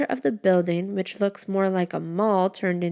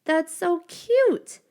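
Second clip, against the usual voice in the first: much higher, slow, squealing delight; gushing; 11 semitones higher, 4 dB louder, much slower than usual. A narrator's reading. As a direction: drag it out like seeing a puppy. Tori gushing over mushroom house.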